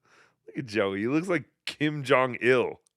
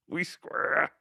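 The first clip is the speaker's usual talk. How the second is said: whisper; 6 dB quieter than usual. Shown as a demonstration. Whispered repeat of "We square.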